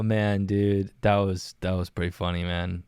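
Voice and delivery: ordinary talk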